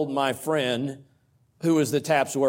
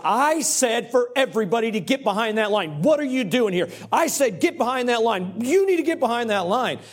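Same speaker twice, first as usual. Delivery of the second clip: irate scolding; 6 semitones higher than usual. Retold or imitated. Race official scolding a coach to get back, angry. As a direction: bark the order at him like an irate official. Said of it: "began to chide me, and not in a kind way".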